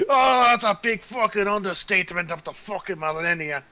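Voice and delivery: exclaiming rough character. Acted. Game master plays exclaiming Jacques.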